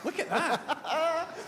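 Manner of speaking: slow, giggly echo; laughing. Real, not acted. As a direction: laugh through the words.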